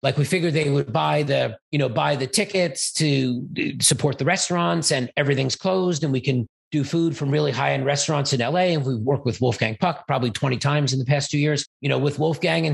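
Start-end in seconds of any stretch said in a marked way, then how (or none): none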